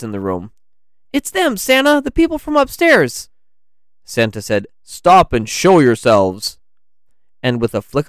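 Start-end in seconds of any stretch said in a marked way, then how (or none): 1.1-3.3 put-on very high, loud young character voice; shrill alert to a companion; alarmed exclamation. A narrator's reading. Lance warns Santa about intruders, alarmed. 4.8-6.6 loud, slow command; commanding call. A narrator's reading. Santa confronts two silhouettes, commanding.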